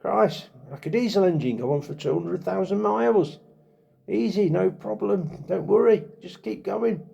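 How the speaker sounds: high, upbeat pep talk to your own body; cheery inner voice. Pretend. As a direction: cheer yourself on like a carefree optimist. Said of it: "You're actually saying to your body".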